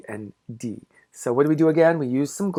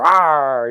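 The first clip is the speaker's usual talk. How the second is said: mock dinosaur roar; 7 dB louder than usual. Dinosaur card pretends to bite.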